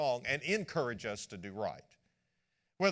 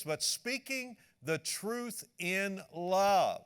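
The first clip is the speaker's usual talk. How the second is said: slow emphatic quote; 6 semitones higher, 3 dB louder, much slower than usual. Shown as a demonstration. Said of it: "Get that".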